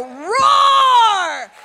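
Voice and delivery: long, high, loud bellowing roar; full roar. Acted. Preacher roaring at the crowd, a long loud bellow. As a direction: let out a huge roar. Preacher roars after David's cry.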